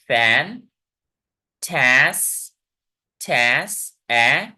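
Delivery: फैन and टैस separated, open vowels stretched; drawn-out rising beats on फैन, टैस, ए; syllable by syllable. Shown as a demonstration. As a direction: say टैस alone, then lift out its vowel ए. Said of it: "आप ध्यान से सुनिए".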